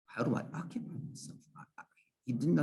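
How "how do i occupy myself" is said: a quick baffled whisper under the breath; half-voiced puzzled murmur. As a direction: whisper it to yourself, fast and confused. Student repeats question, puzzled.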